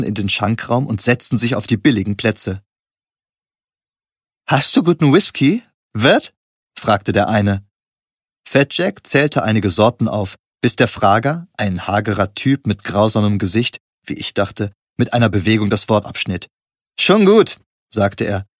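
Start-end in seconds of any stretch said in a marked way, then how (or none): 4.5-6.3 higher, sneering demand; a thug testing the barkeeper; 1 gruff stranger. A narrator's reading. Thug at the bar sneers at the barkeeper. Menacing stranger asks bartender. 17.0-17.6 sharp, much higher snap; 1 curt cutoff. A narrator's reading. Thug cuts off the barkeeper, sharp and curt.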